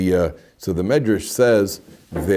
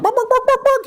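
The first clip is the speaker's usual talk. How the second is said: very high, squawking barnyard crow; mock rooster crow; 4 dB louder than usual. Grandfather crows like a rooster to amuse grandchildren, squawking high. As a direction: ham up a rooster crowing for the grandkids. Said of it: "crow like a rooster".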